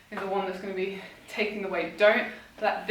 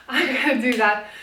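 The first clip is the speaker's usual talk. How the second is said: usual speaking voice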